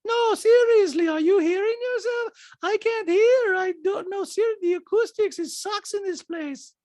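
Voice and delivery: high, loud mimicking voice; Diana. Diana complaining about the acoustics, high mock impression. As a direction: imitate her complaining up high. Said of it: "Diana's like".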